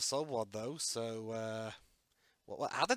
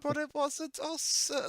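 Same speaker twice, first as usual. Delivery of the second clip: high henchman voice; 14 semitones higher than usual. Pretend. Voicing a henchman's protest.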